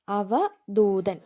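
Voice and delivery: അവധൂതൻ stretched out very slowly, syllable by syllable; slow careful repeat. Shown as a demonstration. Teacher repeating the word so students can write it, very slowly. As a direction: spell out അവധൂതൻ slowly so they catch the ദ. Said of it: "ആ ദാ ഏതാന്ന് നോക്കണം".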